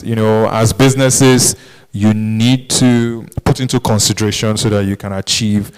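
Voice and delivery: usual speaking voice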